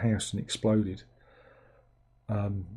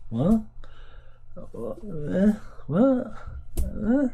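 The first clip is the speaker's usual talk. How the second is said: high, rising bewildered "what? eh?"; mock confusion at the screen; confused viewer squeaking "what?"; baffled "what?"; 9 semitones higher, much slower than usual. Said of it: "and so you just go".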